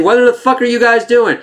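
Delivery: high-pitched mock alarm; imitated incredulous yell. Recapper voicing a man on the train's side, high mock alarm. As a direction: cry out high in disbelief. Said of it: "he's on the side saying".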